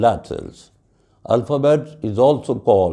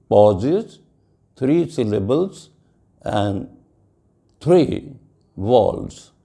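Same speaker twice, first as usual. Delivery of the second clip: ordinary talk, nothing marked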